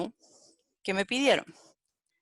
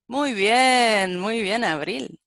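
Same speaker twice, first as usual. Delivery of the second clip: loud, drawn-out first "muy bien", then easing; mock self-congratulation; 2 semitones higher, 5 dB louder, much slower than usual. Real, not acted. Teacher spots own forgotten return, mock self-congratulation. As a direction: congratulate yourself ironically on a silly slip.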